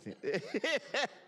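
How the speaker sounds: sudden high laugh at a colleague's remark; burst of real laughter. Real, not acted. Senator laughing at a colleague's joke on the floor, real laughter. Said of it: "y'all excuse me for laughing".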